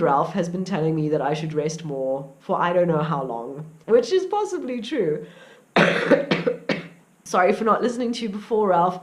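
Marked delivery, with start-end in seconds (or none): 5.7-6.9 rough hacking cough; sick cough mid-sentence; real cough. Real, not acted. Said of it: "hacking and coughing".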